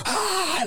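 high-pitched mock scream; imitated kids' scream. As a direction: mimic screaming children with a high shriek. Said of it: "like, screaming".

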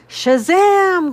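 very loud, high superhero cry; yelled. A narrator's reading. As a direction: belt out a comic-book battle cry. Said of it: "Gladys yelled".

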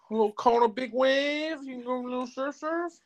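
put-on very high, cooing baby talk. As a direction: coo it like talking to a baby. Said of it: "It's just my baby voice".

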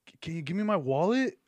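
acted-out request from upstairs; calling down to cousin. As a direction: call it down the stairs. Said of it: "He was like".

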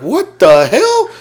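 startled. Retold or imitated. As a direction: stretch it out in comic disbelief.

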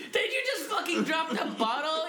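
high-pitched mock outrage; incredulous. Friend hearing the wine bottle drop, incredulous. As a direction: pitch it way up in disbelief.

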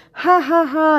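high, loud fake ha-ha-ha; mock laughter. Imitating exes joking passive-aggressively, mock laughter. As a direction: spell out a hollow laugh.

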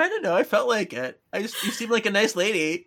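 put-on high, innocent little boy voice; kid voice. Boy explaining to Piper in a high innocent voice. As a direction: play the boy, sweetly high. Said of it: "He's like".

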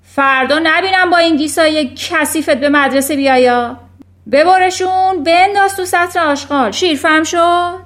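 loud, high bellowed order; roaring. A narrator's reading. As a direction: bellow it like a furious headmistress. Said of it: "نعره کشید".

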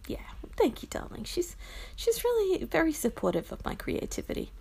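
hushed whisper, voice up high. Real, not acted. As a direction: breathe it out softly. Hushed aside about the partner.

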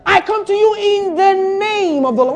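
loud, high preaching as David; defiant declamation. Acted. Preacher voicing David to Goliath, defiant declamation. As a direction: proclaim it as a battle challenge.

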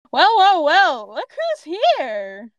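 high, loud mock-sinister well well well, then slow; gloating villain greeting. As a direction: savour catching them, drawn out.

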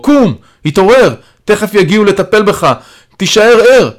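high, loud pleading commands; urgent shouting. A narrator's reading. Calling to dying Stasha to stay awake, urgent shouting. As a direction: shout to keep someone awake. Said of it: "אני קורא לעברו".

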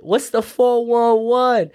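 put-on very high gossipy girlfriend voice; sassy donkey. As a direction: squeal it like greeting a bestie. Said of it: "And the donkey's like".

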